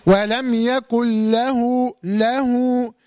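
slow chant with "له" drawn out as "lahuu"; lengthened linking ha. Shown as a demonstration. Teacher pointing out the small waw, chanting "له" with a long ending. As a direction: hold a long u after the ha of "له". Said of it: "شايف الواو الصغيرة اللي تحت الهاء".

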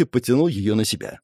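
ordinary delivery

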